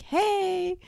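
high, drawn-out New Yorker hello; New Yorker greeting. Retold or imitated. Greeting a fellow New Yorker customer, high and drawn out. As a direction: sing out a slow, high New York greeting. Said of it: "The New Yorker spiel comes out".

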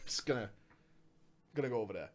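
low, very soft retreat; quiet aside. Pretend. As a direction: murmur it as if slinking away. Backing away from own bit.

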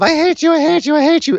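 imitating a furious bride; mock screaming. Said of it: "Lana screaming at Rusev".

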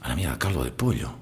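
low, very soft mutter; muttered discovery. Acted. Hungover man finds chicken broth by the bed, low muttering. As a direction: mumble it to yourself, barely awake.

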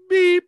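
loud, very high beep; imitated phone beep. Prankster mimics his friend's phone key, high loud beep. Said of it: "Press one beep for yes".

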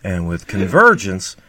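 Steve impression. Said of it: "that's my Steve".

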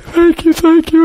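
high-pitched, overcome thanks; imitated grateful sister. Elder sister thanks the departing preacher, imitated high gratitude. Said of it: "She's like".

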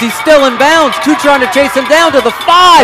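building to a full-throated shout as he breaks free; touchdown call. Real, not acted. Live touchdown run, rising call.

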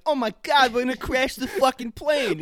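mock panicked Wahlberg. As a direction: shout like a movie hero in a crash. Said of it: "And Mark Wahlberg's like".

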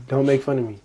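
ordinary delivery

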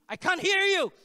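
high, loud shouted challenge; drill-sergeant yell. Retold or imitated. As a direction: bark it like a Navy instructor. Ex-Navy pastor drilling faith.

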